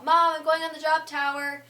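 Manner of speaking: loud call to mom; retold yell. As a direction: shout the news across the ride. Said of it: "and I yell".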